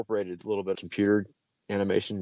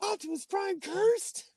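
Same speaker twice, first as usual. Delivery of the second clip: shocked; much slower than usual. Retold or imitated. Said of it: "I'm like".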